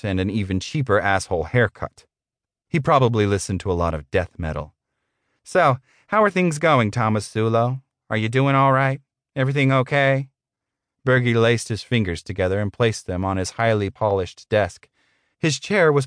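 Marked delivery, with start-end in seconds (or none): 5.4-10.3 high-pitched, falsely concerned boss; smarmy middle manager making small talk; condescending boss. Acted. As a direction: check on the underling in a put-on managerial voice.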